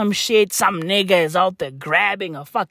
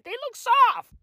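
squealed gush. Shown as a demonstration. Squealed then repeated plainly.

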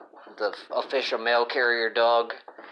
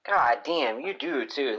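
normal talking voice

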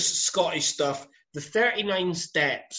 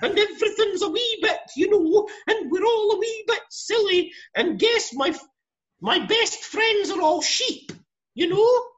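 much higher, laughing mock Scottish lilt; mock old Scottish film accent; 4 dB louder than usual. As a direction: send up an old Scottish cinema accent, squeaky and silly. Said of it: "talk a little bit like this".

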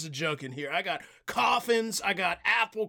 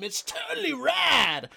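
surfer dude; 6 semitones higher than usual. Acted. Surfer clerk.

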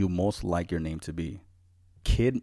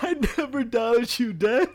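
laughing; 13 semitones higher, 3 dB louder than usual. Retold or imitated. High laughing quote.

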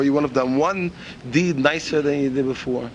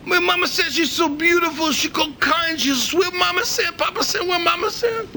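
Chinese waiter voice; 12 semitones higher, slower than usual. Acted. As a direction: cry out for Mama Sam in broken English. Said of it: "the Chinese guy says".

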